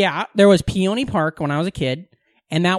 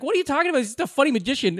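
higher, softer incredulous protest; protesting husband; 8 semitones higher, 4 dB quieter, faster than usual. Retold or imitated. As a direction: play the baffled husband brushing it off. Husband defends magician to wife.

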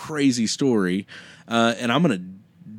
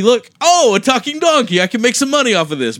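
high, loud gleeful voice; greedy delight at a talking donkey, much higher; excited mock reaction; 11 semitones higher, 6 dB louder, faster than usual. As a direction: act thrilled like you found a money-maker. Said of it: "My reaction would be".

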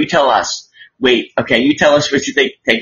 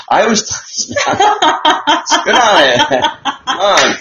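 laughing; 9 semitones higher, much slower than usual. Real, not acted. Laughs about spitting.

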